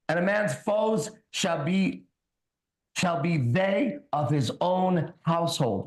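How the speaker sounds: slow, solemn Bible reading; scripture reading. Acted. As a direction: read each word like a sermon. Reads Bible aloud.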